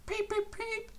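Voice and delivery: very high piping peep; cheerful whistle. A narrator's reading. Henry greets boys on the bridge, high whistle peeps. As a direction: pipe like a train whistle. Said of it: "he called".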